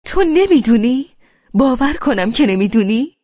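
he turns and shouts at her in disbelief; furious shout. Acted. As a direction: rage that she pretends not to know. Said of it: "یک دفعه عصبانی گفت".

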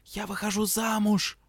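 high, hushed joyful news; joyful whisper. A narrator's reading. Friend whispering wedding news at formation, joyful whisper. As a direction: whisper the news bursting with joy. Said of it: "радостно прошептала".

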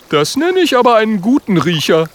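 high, loud character voice; high-voiced admiring little fellow; impressed young friend. A narrator's reading. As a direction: marvel in a high voice.

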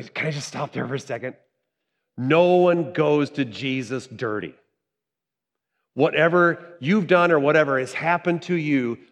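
ordinary delivery

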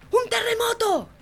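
high, drawn-out cry of alarm; false alarm shout. Acted. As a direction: shout a warning to make everyone run. Joke prisoner raises false alarm. (grito agudo y alargado de alarma)

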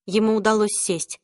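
ordinary delivery

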